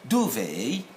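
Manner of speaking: DUVET stressed on the first syllable, DOO-vay; British stress. Shown as a demonstration. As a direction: lean on the opening of "Duvet". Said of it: "in the United Kingdom".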